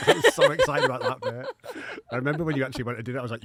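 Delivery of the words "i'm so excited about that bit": high, slow, laughing squeal of glee; laughing excitement. Pretend. Repeats line laughing, much higher.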